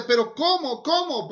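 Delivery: acting out a baffled objector; incredulous objection. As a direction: protest it twice, pitch jumping up. Moses keeps objecting to God.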